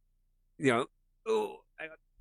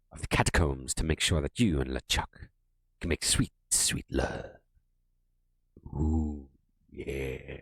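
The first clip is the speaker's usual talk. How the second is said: low, slow seductive voice; sexy skull voice; 5 semitones lower, 3 dB quieter, slower than usual. Pretend. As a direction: purr it like a flirty skull. Streamer voicing the skull flirtatiously.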